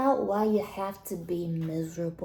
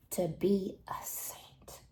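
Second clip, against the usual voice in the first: whispered aside; 2 semitones lower, 9 dB quieter, slower than usual. Acted. Whispered aside about saints.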